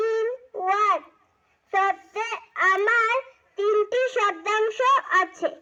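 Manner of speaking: loud recitation. Acted. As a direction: declaim it to the whole class. Child reciting trisyllable role.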